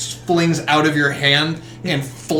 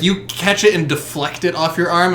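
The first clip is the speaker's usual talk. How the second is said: normal talking voice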